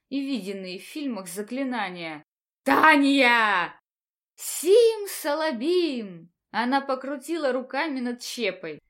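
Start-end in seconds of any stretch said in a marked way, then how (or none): 2.6-3.8 angry shout. Acted. Teacher shouts her name. 4.4-6.3 mock incantation.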